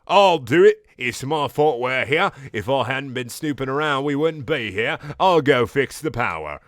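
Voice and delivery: hammed-up British character voice; Garnet's put-on British accent.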